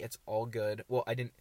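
ordinary delivery

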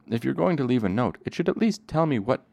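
high, loud annoyed demand; exasperated. Acted. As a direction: demand an answer, fed up. Bast demanding, upset.

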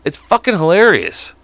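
ordinary delivery

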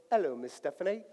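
lightly put-on British butler; British accent, hired-butler formality. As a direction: greet her in a mock British accent. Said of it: "Can you speak a British accent?".

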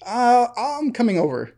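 stunned reply, retold with amusement; retold disbelief. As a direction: imitate a man slowly taking in bad news. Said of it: "like, a little bit of disbelief".

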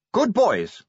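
high, loud praise to animals; calling out. A narrator's reading. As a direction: call out heartily to the dragons. Sybil urging on her dragons.

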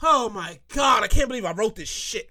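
usual speaking voice

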